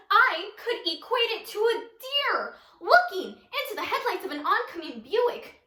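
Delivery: high, animated rant, speeding up toward the end; worked-up monologue character. Acted. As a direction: rattle out the breakup comparison. Monologue character on failed relationship.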